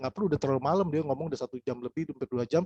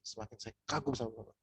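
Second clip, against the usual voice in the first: leaning in, much softer, admiring; soft admiring line; 3 semitones lower, 9 dB quieter than usual. Shown as a demonstration. To a proud prospect, leaning in with soft admiration. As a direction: say it low and close, like a confidence. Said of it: "Intonasi kalimatnya itu juga perlu diatur".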